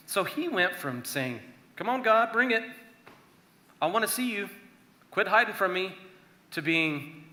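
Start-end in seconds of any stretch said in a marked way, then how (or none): 1.8-6.0 higher, louder mock challenge to God; frustrated demand. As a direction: call God out, fed up. David voiced demanding God show.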